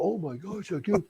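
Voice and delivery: mock-amazed audience member; amazed crowd member. A hint of a stunned bidder at the auction. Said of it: "people go".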